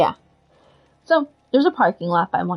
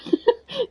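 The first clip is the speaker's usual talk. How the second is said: high laugh; 14 semitones higher than usual. Real, not acted. Laughing at own joke.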